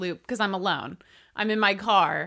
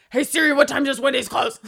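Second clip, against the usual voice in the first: raised, urgent call to a voice assistant; calling to Siri; 4 semitones higher, 6 dB louder than usual. Retold or imitated. Driver asks phone while rounding the loop, raised call. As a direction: shout a quick question at the phone. Retold shouted question to phone.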